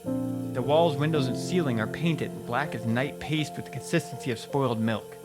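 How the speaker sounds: usual speaking voice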